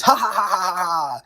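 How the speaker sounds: a fake villain laugh that trails off; mock maniacal laugh. Mocking Rollins laughing every other word, light cackle. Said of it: "Every other word was".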